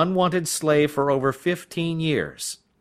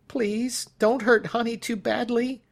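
plaintive plea; 12 semitones higher than usual. Acted. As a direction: beg like a frightened little child. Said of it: "he said in a plaintive tone".

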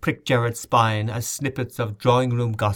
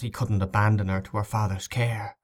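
ordinary delivery

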